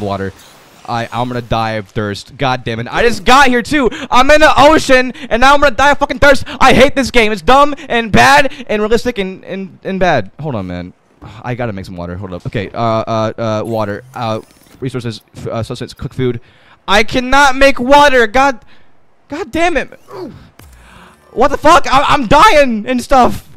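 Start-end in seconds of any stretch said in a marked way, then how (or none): 2.9-10.3 angry rant. Real, not acted. Furious at dying of thirst. 16.9-23.6 frustrated yell. Real, not acted. Frustrated, can't make water.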